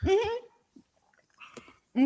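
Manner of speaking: humming through a mouthful; mm-hmm with food in mouth; mouth full. Real, not acted. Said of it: "I don't talk with my f-, mouth full".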